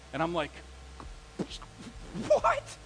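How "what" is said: high-pitched, louder cry of disbelief; shocked. Retold or imitated. Teenage boyfriend reading a breakup letter, shocked. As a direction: squeak it out in stunned disbelief.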